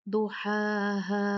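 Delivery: slow "दुहाहा" with long held vowels; "दुहाहा" chanted, long ending; two-count madd. Shown as a demonstration. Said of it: "दो count मद करके".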